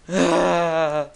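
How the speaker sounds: long loud "aah" yell; loud drawn-out cry; alarmed scream. Real, not acted. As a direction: scream while fleeing danger. Screaming while fleeing.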